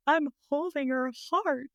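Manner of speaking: high, plaintive mock explanation; retold tearful protest. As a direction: imitate a weepy trainee defending herself. Tearful trainee explains herself.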